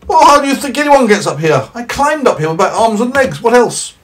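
high, loud cheery snowman. Acted. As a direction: play the indignant snowman, high and bright. Snowman voiced.

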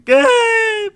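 mock scared cry. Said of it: "Gabe, I'm scared".

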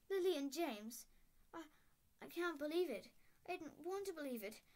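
lightly voiced stern witch in shock; McGonagall upset. A narrator's reading.

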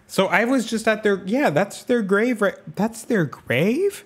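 high, slowing shocked question; shocked realization. Real, not acted. Streamer realizing she visited their grave, shocked. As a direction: it dawns on her mid-sentence.

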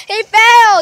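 high, loud laughter through the words; shrieking laugh. Real, not acted. Girl laughing loudly as her brother falls. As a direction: cackle at the fall.

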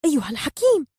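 high, loud call; scolding shout. A narrator's reading. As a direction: shout his title in reproach. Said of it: "فصاحت سرابي عليه".

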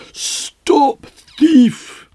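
loud angry shout Stop thief. Acted. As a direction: bellow it like an angry gardener.

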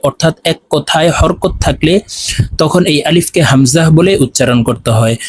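normal talking voice

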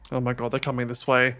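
ordinary talk, nothing marked

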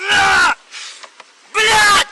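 very high, very loud outburst; real shout. Real, not acted. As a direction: yell out sharply in frustration. Cries out in the struggle.

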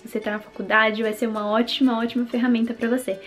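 upbeat sponsor read. Acted. Sponsor read before the vlog.

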